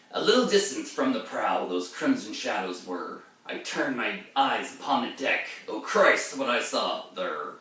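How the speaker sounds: verse recital with a sharp rise on Oh Christ; heightened reading of ballad verse; dramatic poem reading. Acted. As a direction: read it as the haunted mariner.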